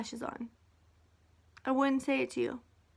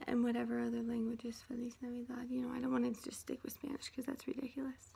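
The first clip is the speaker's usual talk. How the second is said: whisper; 12 dB quieter, faster than usual. Real, not acted. Drops into a sudden whisper.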